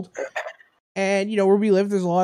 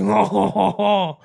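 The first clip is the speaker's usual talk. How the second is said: mock French laugh; 3 dB louder than usual. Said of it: "Then they all laugh like".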